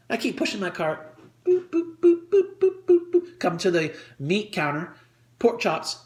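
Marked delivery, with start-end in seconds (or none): 1.4-3.4 high, evenly spaced little boops; light high-pitched booping; boop noises. Acted. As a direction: toot along like a toy cart. Imitates moving along with the cart.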